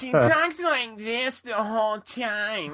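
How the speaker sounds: drawn-out, high-pitched comedy-character voice; slow, stretched, very high parody of a sketch character; Liz. Retold or imitated. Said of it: "imagine it with the Liz ca-, character that Nick Kroll does".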